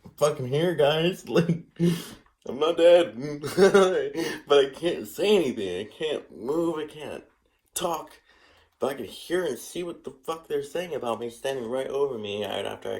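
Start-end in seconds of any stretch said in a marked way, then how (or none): 0.1-8.2 high, loud protest with brief laughs; exasperated, half-laughing mock outcry; retold panicked thought. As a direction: replay a frantic inner plea, pitched way up. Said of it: "mind, I'm like".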